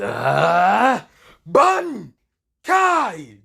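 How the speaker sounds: high, loud shouted Ban Kai; Bankai battle cry. Acted. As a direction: roar out the power release. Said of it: "Issei kinda says".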